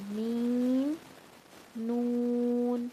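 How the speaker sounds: میم and نون hummed through the nose; nasal sounds; nasal meem and noon. Shown as a demonstration. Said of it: "جو ناک میں پڑے جاتے ہیں".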